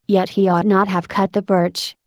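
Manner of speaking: ordinary delivery, nothing marked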